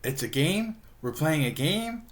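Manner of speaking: high questions with rising ends; puzzled Jughead. Retold or imitated. Jughead thinks it's a game, puzzled. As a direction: imitate innocent confusion.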